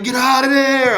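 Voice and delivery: high, loud shout at Apollo to get out; yelling at the screen. Retold or imitated. Watching Apollo get beat by Drago, a high loud shout. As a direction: yell it at the TV like a fan in the fight. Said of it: "I'm like".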